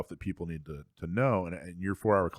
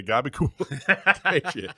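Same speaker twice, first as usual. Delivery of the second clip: laughing; 4 semitones higher, 5 dB louder, much slower than usual. Real, not acted. Joking nickname for a friend.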